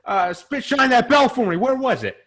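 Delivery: high-pitched put-on British-Irish accent; mock British accent. Quoting McGregor to friends, hammed-up British-sounding accent. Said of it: "what was the British accent?".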